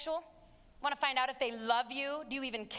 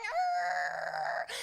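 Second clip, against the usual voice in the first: mock animal growl; 9 semitones lower than usual. Mocking adults who call teens animals, ending in a growl. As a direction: ham up teens as snarling animals. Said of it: "start walking school halls going".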